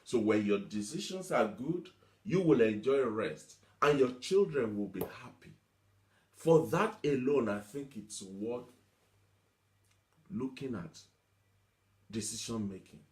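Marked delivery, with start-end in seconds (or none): none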